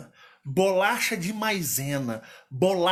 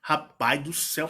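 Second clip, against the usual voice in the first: ordinary delivery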